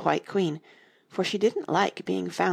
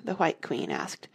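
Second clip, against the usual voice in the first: usual speaking voice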